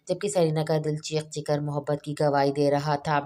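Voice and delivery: usual speaking voice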